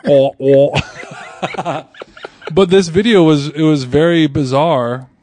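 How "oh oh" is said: "oh, oh" as two open vowel noises; joking vowel noise. Pretend. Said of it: "I've heard you make that noise before".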